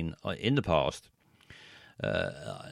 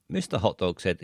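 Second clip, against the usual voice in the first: usual speaking voice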